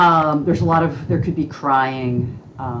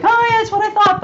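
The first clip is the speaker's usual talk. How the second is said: high mock-confident voice, a little louder; smug know-it-all; 4 dB louder, faster than usual. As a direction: play someone sure they guessed right. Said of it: "It's like".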